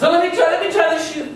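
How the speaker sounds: put-on high, eager pleading girl voice; preening stepsister begging; vain stepsister. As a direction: beg sweetly to try it first. Second stepsister begging to try.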